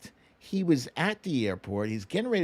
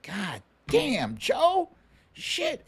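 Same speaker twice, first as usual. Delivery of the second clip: mock dismay; 9 semitones higher, much slower than usual. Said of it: "And I was like".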